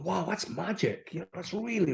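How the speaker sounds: hushed awe. Retold or imitated. Recalling first hearing the show in Aberdeen, hushed awe. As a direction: quietly marvel as if back listening.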